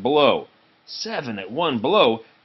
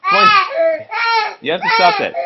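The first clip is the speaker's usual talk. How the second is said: laughing scold; 8 dB louder, much faster than usual. Real, not acted. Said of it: "parent scolding while laughing".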